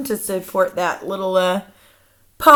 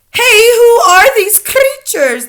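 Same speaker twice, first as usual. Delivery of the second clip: high, loud, drawn-out yell; stretched theatrical shout of alarm; demented yelling; 14 semitones higher, 11 dB louder, slower than usual. A narrator's reading. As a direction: yell like a frantic teacher. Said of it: "demented teachers is yelling".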